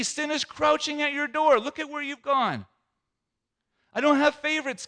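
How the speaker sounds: very high, loud rebuke of Cain; thundering preacher God. Acted. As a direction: preach it full force like a warning from God.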